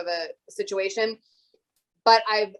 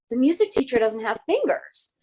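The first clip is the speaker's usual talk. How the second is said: lightly voiced child telling the teacher; a hint of an earnest pupil breaking news; kids reporting; 3 semitones higher, 3 dB louder than usual.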